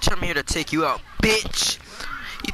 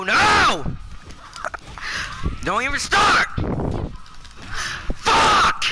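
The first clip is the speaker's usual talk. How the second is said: rising rage; 12 semitones higher, slower than usual. Real, not acted. Gamer to the opponent starting up, rising rage. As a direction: build from protest to screamed curse. Raging at the game.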